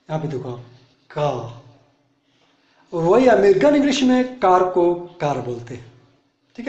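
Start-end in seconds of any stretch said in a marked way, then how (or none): 1.1-1.3 का for car, r dropped, long "kaa"; British pronunciation. Shown as a demonstration. Teacher showing students British car as का, no r. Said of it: "British English में कार को का बोलते हैं".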